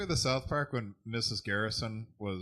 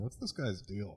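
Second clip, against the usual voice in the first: whispering; 3 semitones lower, 9 dB quieter than usual. Retold or imitated. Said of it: "I'll whisper to the guy next to me".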